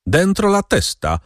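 wysoki, umiarkowanie ostry rozkaz; rozkaż wyższym głosem, bez pełnego wrzasku; rozkazujący okrzyk. A narrator's reading. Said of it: "wrzasnął ktoś rozkazująco i pogroził pięścią". (groźne polecenie podniesionym głosem)

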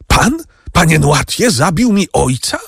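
high, incredulous accusing question; horrified cry. A narrator's reading. Franz confronts Noirtier over his father's killing, horrified cry. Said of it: "zawołał Franc i włosy powstały mu na głowie".

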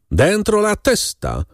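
high, slow repeated order; ominous voice. A narrator's reading. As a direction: draw the command out, pitched high. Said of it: "ten sam złowróżbny głos".

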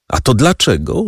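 plain reading voice

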